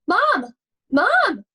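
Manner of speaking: high, loud frightened scream; screaming for mom. Acted. Scared rabbit screams for mom. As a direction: shriek for your mother in panic. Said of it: "Jasper screamed".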